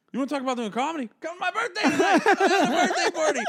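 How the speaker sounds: very high, loud, laughing pitch; overeager invite. Retold or imitated. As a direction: push the invite way too hard, cracking up. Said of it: "You're like".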